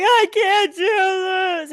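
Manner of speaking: imitating herself crying on the couch; mock crying. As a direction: whimper it out like a hammed-up sob. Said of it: "like, crying".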